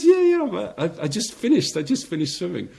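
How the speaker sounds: high, loud, strained words forced out after swallowing; strained with a full throat. Retold or imitated. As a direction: squeeze it out with food stuck in the throat. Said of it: "I said".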